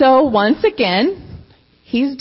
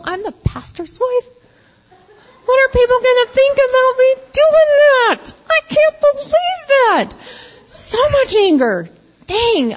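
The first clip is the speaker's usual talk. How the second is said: distraught self-reproach; 11 semitones higher than usual. Retold or imitated. Pastor's wife caught after punching the wall, distraught wail. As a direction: wail at yourself in dismay. Reenacting her past angry outburst.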